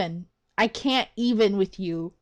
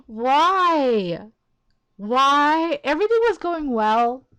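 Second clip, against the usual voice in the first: higher, louder wail of protest; exasperated lament; 4 semitones higher, 5 dB louder, slower than usual. Real, not acted. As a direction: protest as if it's all been ruined. Lamenting the character's arrival.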